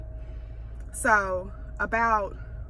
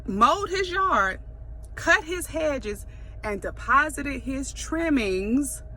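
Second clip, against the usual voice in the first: swearing tirade; 5 semitones higher than usual. Real, not acted. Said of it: "I'm ranting".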